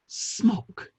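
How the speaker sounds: hushed whisper of a naughty word; scandalised whisper. Acted. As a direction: breathe it as if it's indecent. Saying a risqué word discreetly.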